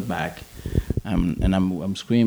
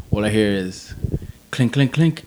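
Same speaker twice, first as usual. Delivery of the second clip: ordinary delivery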